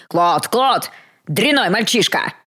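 mock bellow at a useless assistant; angry yell. A narrator's reading. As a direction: drop the politeness and scold loudly. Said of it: "заорал он помощнику совсем другим голосом".

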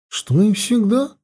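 ordinary delivery, nothing marked

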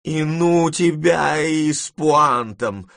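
loud, high, drawn-out dismissal; irritated. A narrator's reading. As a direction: wave him off crossly, slow and loud. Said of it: "раздражительно отмахнулась от него бабушка". (раздражённо, громко и протяжно)

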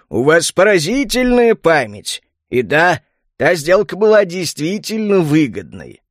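lightly voiced, pleased merchant; smiling merchant. A narrator's reading. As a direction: flatter like a smiling trader. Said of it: "улыбнулся торговец".

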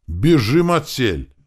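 high, loud call to run; barked order. A narrator's reading. Senior Novgorodian to his carpenters, barked. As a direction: bark it out as an urgent command. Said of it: "рявкнул старший новгородец".

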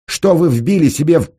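high, loud remonstration cut short; shouted plea. A narrator's reading. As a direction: shout urgently until interrupted. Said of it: "крикнул он". (крикнуть громко, высоко, с отчаянием)

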